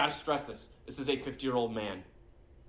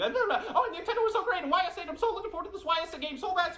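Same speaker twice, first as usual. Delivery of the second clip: very high, fast breathless gushing; mocking impression. Mocking a gamer's breathless gushing, very high and fast. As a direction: gush without stopping for breath. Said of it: "Not breathing as he's talking".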